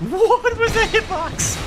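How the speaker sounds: high, loud, slow outraged yell; raging gamer. Real, not acted. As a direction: yell in disbelief at the game. Just missed the attack.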